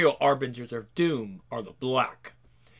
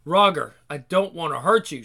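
ordinary delivery

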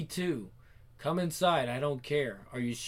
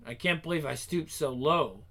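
usual speaking voice

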